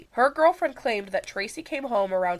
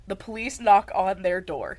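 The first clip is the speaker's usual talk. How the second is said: ordinary talk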